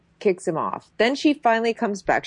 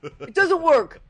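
higher, dopey insistence; mock Chad; 7 semitones higher than usual. Imitating Chad insisting he must punch them. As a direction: whine that there's no other way. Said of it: "And he's like".

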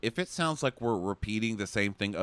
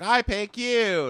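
mock Rachel; 12 semitones higher, 6 dB louder, slower than usual. Mock Rachel picking Peter.

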